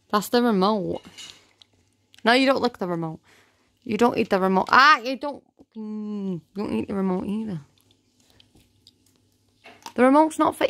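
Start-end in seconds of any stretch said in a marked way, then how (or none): none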